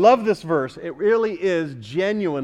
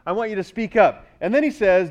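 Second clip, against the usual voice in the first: ordinary delivery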